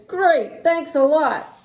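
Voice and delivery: high, loud thanks; pleased bus driver. Acted. Bus driver thanking the readers, high loud voice. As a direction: call out thanks brightly and loudly. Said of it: "And he says".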